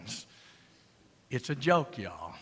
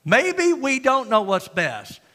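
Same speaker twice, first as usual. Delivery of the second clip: slow emphatic point; 6 semitones higher, 5 dB louder, slower than usual. Real, not acted. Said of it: "punchline slowed".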